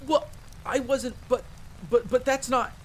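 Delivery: high, stammering denial; flustered protest. Acted. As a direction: stumble over your defence, caught out and embarrassed. Teased about wanting an autograph.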